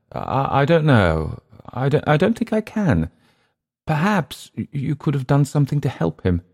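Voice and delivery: high, halting English character voice; hesitant Englishman. Acted. Unsure Englishman replying to his older host's story, high and hesitant.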